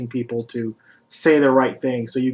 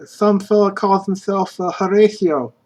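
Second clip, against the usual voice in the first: put-on raised pitch scrappy kid; street kid voice; 7 semitones higher, 4 dB louder than usual. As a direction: answer like a cagey urchin.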